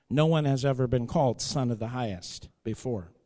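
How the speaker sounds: normal talking voice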